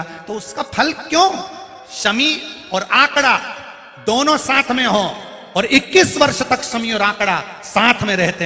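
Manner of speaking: high, loud sermon at full force; fervent preaching. Acted. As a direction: proclaim it to the whole crowd. Preacher raises voice to crowd. (ऊँची, ज़ोरदार प्रवचन की आवाज़)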